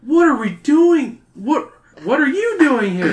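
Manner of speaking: high, loud startled question; surprised kids. A narrator's reading. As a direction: caught off guard, blurt it.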